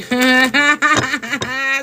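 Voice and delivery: loud burst of real laughter; big laugh. Real, not acted. Reader laughing loudly at her own joke to viewers. Joke about pull out game.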